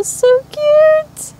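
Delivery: very high, squeaky gush; excited squeal. Real, not acted. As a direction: squeal it like a delighted fan. Reacting to sunshine charm.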